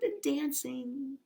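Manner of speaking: soft slow whisper. Acted. Teacher describing dancing mice to kids, whispering. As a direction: breathe it gently. Reading picture to children.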